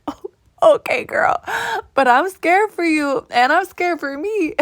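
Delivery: high, mock-nervous reply; mock scared. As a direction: play it scared and giggly. Retelling her own nervous reply.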